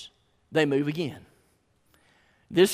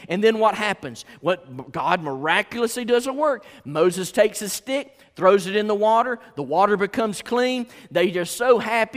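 raised, loud sermon voice; urgent high-energy preacher; forceful preaching; 6 semitones higher, 7 dB louder than usual. Acted. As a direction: drive the story home from the pulpit.

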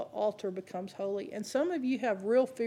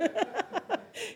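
high laugh; 10 semitones higher, 6 dB quieter than usual. Real, not acted. Laughs at cheese stick story.

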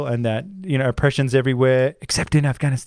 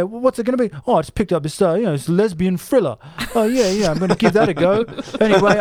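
Sam Mendes impression; 7 semitones higher than usual. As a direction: play a cheeky director bragging to his mates. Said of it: "Imagine Sam Mendes saying".

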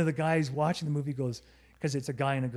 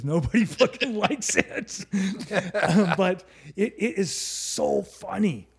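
laughing; 4 semitones higher, 4 dB louder, slower than usual. Real, not acted. Laughing about guilty pleasure.

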